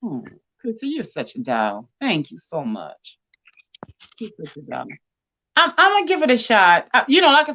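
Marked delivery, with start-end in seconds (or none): 0.0-4.9 much lower, softer and slow, deeply moved; thanking a friend for kind words, softly moved; touched thanks. Real, not acted. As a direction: near a whisper, tender gratitude.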